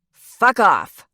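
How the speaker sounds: loud, high angry yell; shouted from within. A narrator's reading. Lyra yelling at the prince from inside, angry shout. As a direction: bark it back through the door. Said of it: "a feminine voice called from within".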